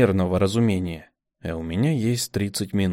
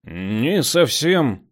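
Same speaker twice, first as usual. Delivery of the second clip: slow careful start; hesitant, drawn out; cautious; 4 semitones higher, much slower than usual. A narrator's reading. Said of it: "осторожно начал замес".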